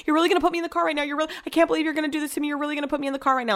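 high, racing, panicked disbelief; retold panicked plea, fast and high; imitated panic. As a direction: tumble over the repeats as if panicking. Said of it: "I can hear it in her voice".

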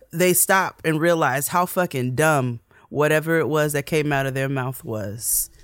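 ordinary delivery

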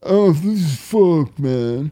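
slow drawn-out mock complaint; mock whiny groan. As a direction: drag it out like a sulky kid. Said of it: "complaining kid imitated".

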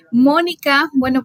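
ordinary delivery

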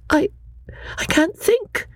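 put-on high, earnest young woman's voice; young Lucy. Lucy answering her cousin Charlotte, earnest and high.

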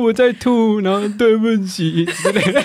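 high, slurred drunk imitation with a laugh; imitating drunk friend. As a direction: play the drunk girlfriend apologizing. Mimicking drunk friend on phone.

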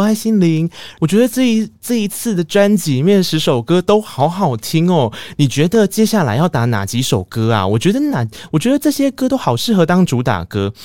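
high, eager fangirl chatter; a fan at a signing gushing over the album. Retold or imitated. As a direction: pour compliments onto the singer. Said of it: "我那时候上台就跟他说".

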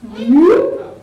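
loud rising bloop; cartoon sound effect, swooping upward; imitated bloop. Storyteller adding sound effects to her story, loud swooping bloop.

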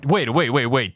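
normal talking voice

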